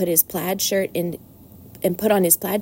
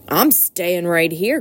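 slow grumbling rat voice; slower than usual. Acted. The rat refusing to go to the fair, grumbling slowly. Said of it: "grumbled the rat".

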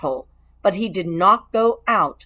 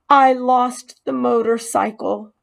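a touch higher, stressed on I; owning up, ashamed; confessing mouse; 5 semitones higher than usual. Acted. Mouse confessing to the boy, mildly ashamed.

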